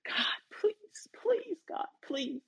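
high, strained cries begging God for rescue; frantic surfer begging God to save him, high and strained; desperate pleading. Retold or imitated. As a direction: plead for your life, voice climbing high. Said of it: "Back to the surfer".

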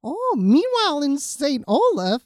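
high, chirpy Rose Nylund. Retold or imitated. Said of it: "That's my Rose Nylund".

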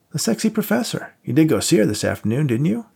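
lightly voiced buddy. A narrator's reading. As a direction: play the cocky friend lightly. Voiced friend Max.

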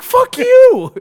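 very high, loud mock outrage; hammed-up furious yell; retold angry shout. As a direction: explode in comic exasperation. Retold blow-up at the heckler.

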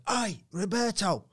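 high-pitched holler at a passer-by; bloke calling out. Retold or imitated. Bloke in Cleethorpes hailing the comedian after a gig, calling out high. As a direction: imitate a bloke hailing someone across the street. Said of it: "this bloke went".

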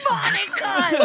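high mock wail with laughter; losing racer's cry. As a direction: wail the name as the race slips away. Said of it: "When he starts to lose, he's like".

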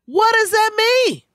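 very high, loud, incredulous squawk; outraged disbelief. Retold or imitated. Retelling a childhood insult, outraged disbelief. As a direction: push it way up high, loudly demanding an answer. Repeats the question, more outraged.

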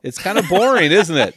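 high and loud, laughing through the words. Real, not acted. Host joking with the guest about his own plain accent, laughing. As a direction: giggle at your own dull accent.

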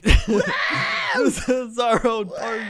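high, loud, drawn-out party holler; mock rowdy yell. Rowdy diner at their own party, hammed-up yelling. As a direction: whoop it out slowly like a rowdy guest. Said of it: "so rowdy that you were just like".

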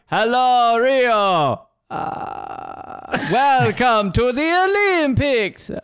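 hammed-up shaky welcome; mock nervous speaker. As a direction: play a trembling official at the mic. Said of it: "we'd be, like, going".

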